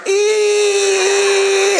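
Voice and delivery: very high squeal held on one note; translator's long eee. Retold or imitated. Translator keeping up with the preacher, long high squealed eee. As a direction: imitate an endlessly stretched emphasis sound. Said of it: "the guy's like this".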